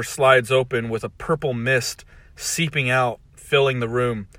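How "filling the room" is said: ordinary delivery, nothing marked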